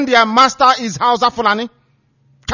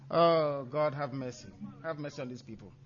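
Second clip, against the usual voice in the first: much softer, lower, despairing mutter; muttered aside; 6 semitones lower, 19 dB quieter than usual. Real, not acted. Host mutters a prayer in despair, much softer and lower. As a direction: sigh it to yourself, in despair.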